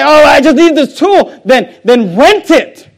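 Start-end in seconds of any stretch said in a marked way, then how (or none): 0.0-1.4 a higher, louder mock customer excuse. Preacher mocking a shopper's excuse, higher and louder. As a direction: toss off the excuse like a careless shopper.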